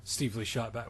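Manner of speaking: normal talking voice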